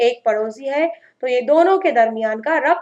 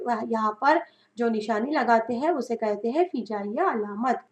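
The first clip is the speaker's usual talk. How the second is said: ordinary delivery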